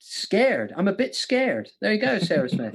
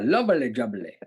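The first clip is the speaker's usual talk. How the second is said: low catchphrase; 7 semitones lower than usual. Acted. Host repeats the catchphrase after the laughs, low put-on voice. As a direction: drop it right down and draw it out.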